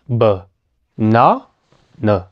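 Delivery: "banana" in three spaced syllables, relaxed lips. Shown as a demonstration. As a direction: say "banana" as buh, NA, nuh, pausing between each. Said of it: "solo fíjate en mis labios".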